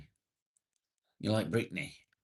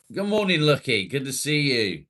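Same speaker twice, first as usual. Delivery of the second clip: higher and louder, cheerful hello; excited greeting; 8 semitones higher, 10 dB louder than usual. Real, not acted. Streamer greets Lucky joining chat, loud and excited. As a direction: beam it out loud to a friend.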